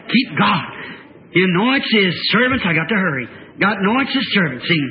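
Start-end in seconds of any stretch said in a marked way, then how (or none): none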